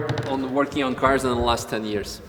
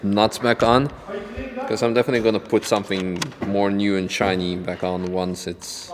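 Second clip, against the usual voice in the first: ordinary talk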